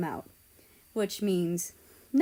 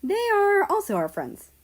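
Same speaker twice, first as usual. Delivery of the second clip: put-on much higher, louder young voice; Canada voice; 11 semitones higher, 6 dB louder than usual. Canada introducing friends, high and loud. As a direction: pitch it up high and bright. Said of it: "said Canada".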